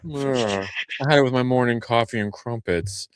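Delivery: mock-posh British accent, lightly put on; a hint of a posh British accent. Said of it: "that's my posh voice".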